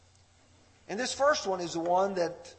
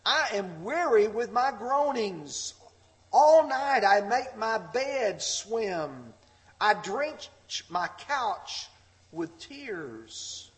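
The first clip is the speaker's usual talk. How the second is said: raised, slow lament, falling away at the end; impassioned scripture reading; 5 semitones higher, 5 dB louder, slower than usual. Acted. Preacher voicing David's weary lament to the congregation, impassioned. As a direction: preach the psalm in a high, drawn-out voice.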